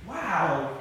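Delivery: ordinary talk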